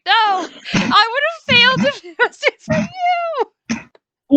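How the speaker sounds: loud, high burst of gratitude; excited outcry. Real, not acted. Player thanking a fellow player after the roll, excited outcry. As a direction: cry out, voice leaping up and loud.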